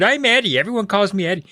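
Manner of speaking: put-on high-pitched kid correcting; insistent. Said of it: "my brother insisted".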